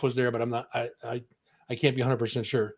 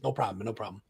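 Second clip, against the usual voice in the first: mellow laid back; 5 semitones lower, 9 dB quieter, faster than usual. Retold or imitated. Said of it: "he was just mellow and laid back".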